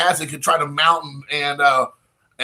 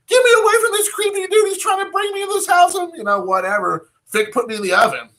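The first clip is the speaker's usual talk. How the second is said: much higher cartoon cat voice; voiced cat; 14 semitones higher than usual. Cat owner voicing his cat fleeing him, high squeaky. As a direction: squeak it like a panicked pet. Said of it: "was like".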